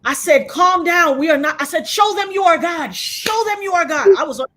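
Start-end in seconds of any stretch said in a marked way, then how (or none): 0.5-1.6 raised, loud retold command; forceful, higher-pitched order; commanding shout. Retold shouting on turbulent plane. 1.9-4.1 high, loud plea to heaven; retold full-voiced prayer shout; fervent prayer. Retold shouted prayer during turbulence.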